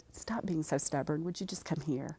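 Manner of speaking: usual speaking voice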